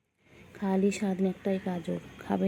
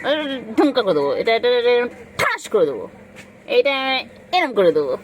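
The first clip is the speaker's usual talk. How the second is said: mock threat; 4 semitones higher, 15 dB louder than usual.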